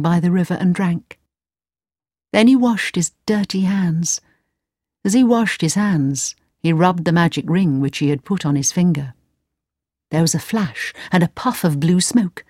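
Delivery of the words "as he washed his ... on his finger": plain reading voice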